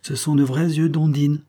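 put-on high, lively girlish boast; heedless, impulsive. A narrator's reading. Girl showing Jacques her eyes up close, heedless. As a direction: announce it airily, pitched well up. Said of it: "ajouta-t-elle étourdiment".

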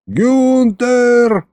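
high, long-drawn call for a servant; yelled summons. A narrator's reading. Narrator calls the butler for drinks, yelled summons. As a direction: holler the name, stretching it out. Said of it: "заорал я".

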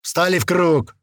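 loud commanding bark. A narrator's reading. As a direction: bark it out for everyone around. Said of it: "рявкнул на всю округу".

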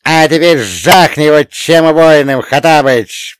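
put-on loud, rousing old man's urging; egging on. A narrator's reading. Old man goading the wizard to attack, loud egging on. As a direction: goad him loudly into striking. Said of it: "продолжал подзуживать меня старикан".

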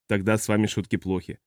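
usual speaking voice

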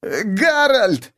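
high, drawn-out whimpering call; pained whimper. A narrator's reading. Beaten captive calling his comrade, high whimper. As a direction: whimper the name slowly, very high. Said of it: "вскрикнул от боли и проскулил".

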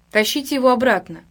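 usual speaking voice

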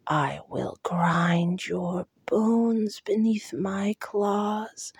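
ancient hollow voice, slow and breathy; hollow creature whisper. Acted. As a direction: whisper like an ancient thing in the mind. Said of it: "hollow voice whispered".

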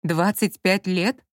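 plain reading voice

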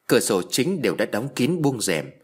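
ordinary delivery

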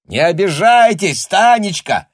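high, loud call; shouted through door. A narrator's reading. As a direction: call out to someone in another room. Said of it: "крикнул из-за двери хозяин".

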